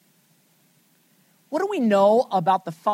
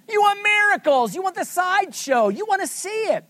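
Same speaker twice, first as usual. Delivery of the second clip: much higher, louder mock scolding of the crowd; rebuking shout; 11 semitones higher, 5 dB louder than usual. Teacher voicing reproach to miracle-hungry Galileans, shouting high. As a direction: throw it at them like an exasperated preacher.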